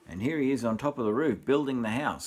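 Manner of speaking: plain reading voice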